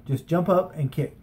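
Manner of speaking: usual speaking voice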